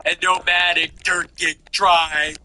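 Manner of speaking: slow, drawn-out mock of a dramatic pause; mocking her delivery. Host mocking the commentator's theatrical delivery, slow and drawn out. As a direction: hang on every word like she does. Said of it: "You ever notice how she does that".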